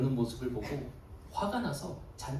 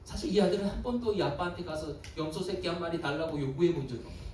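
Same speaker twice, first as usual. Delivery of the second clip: ordinary talk, nothing marked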